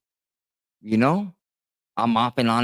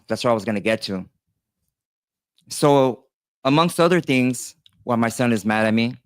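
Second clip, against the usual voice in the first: usual speaking voice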